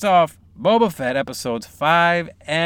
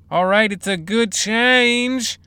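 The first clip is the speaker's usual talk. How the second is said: ordinary talk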